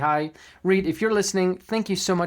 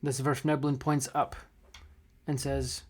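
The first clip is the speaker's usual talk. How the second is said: somber game master narration; 3 semitones lower, 6 dB quieter than usual. A narrator's reading. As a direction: narrate the death scene gently. Game master narrates dying gnome.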